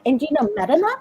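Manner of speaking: put-on French friends quizzing about pop stars, French accent. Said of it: "With their accent".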